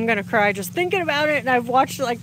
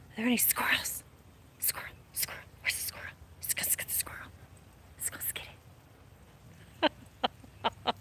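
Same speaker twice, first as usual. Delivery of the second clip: hushed conspiratorial whisper; whispering to the dog; 16 dB quieter than usual. Pretend. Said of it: "Unless she sees a squirrel".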